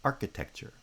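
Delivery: main stress on the first syllable of architecture; architecture, strongest at the start, lighter third syllable; primary stress on first foot. Shown as a demonstration. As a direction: hit the opening syllable of architecture. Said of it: "architecture has primary stress on the first foot".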